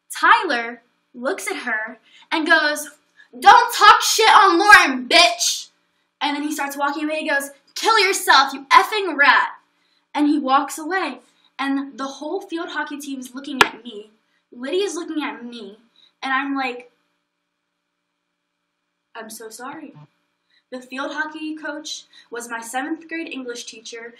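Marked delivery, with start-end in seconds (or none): 3.4-5.7 loud, high, slow warning; angry shout. Retold or imitated. Tyler telling the bully off, loud angry shout. 7.7-9.5 loud, higher insult thrown back; angry parting shot. Retold or imitated. Tyler insulting the bully as he leaves, loud and angry. 19.2-19.9 much softer mortified sorry; embarrassed soft apology. Retold or imitated. Apologising to the watching team, soft and mortified.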